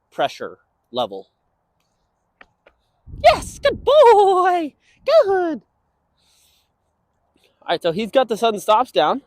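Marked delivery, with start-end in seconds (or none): 3.2-5.6 drawn-out yes, high praise; praise for dog. Real, not acted. Trainer rewarding dog's stop, slow high praise. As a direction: stretch the yes, lift it high and louder.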